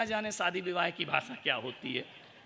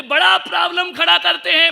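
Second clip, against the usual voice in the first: much higher and louder, hammed-up yelling wife; mock shout; 11 semitones higher, 15 dB louder than usual. Wife yelling to the monk about her husband, hammed-up shout. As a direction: bellow it like an exasperated wife.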